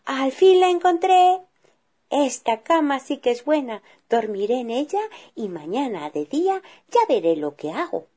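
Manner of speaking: put-on a small girl pleased to find her bed; contented little girl. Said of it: "muy contenta dijo".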